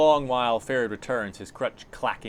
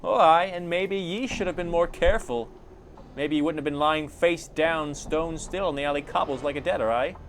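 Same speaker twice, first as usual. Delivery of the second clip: high, indignant put-on accent; retorting Anna; 6 semitones higher, 4 dB louder than usual. Anna blames the player for lying still, indignant. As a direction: throw the blame straight back, higher.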